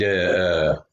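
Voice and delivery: ordinary delivery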